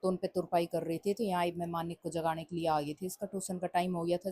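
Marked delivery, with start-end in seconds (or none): none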